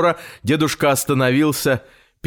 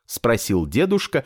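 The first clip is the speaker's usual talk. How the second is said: ordinary delivery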